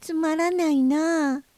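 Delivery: high, drawn-out little whine; slow, disappointed moan to yourself; small creature's high sulk; grumbling to oneself. Acted. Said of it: "独り言を言っています".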